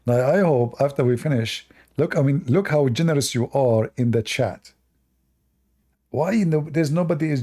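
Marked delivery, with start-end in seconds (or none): none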